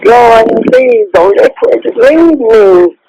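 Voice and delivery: slow, drawn-out desperate plea; real anguished begging; anguished prayer. Real, not acted. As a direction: plead with God, stretching the words in grief. Praying as partner may be dying.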